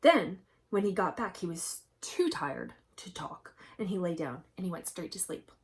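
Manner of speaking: usual speaking voice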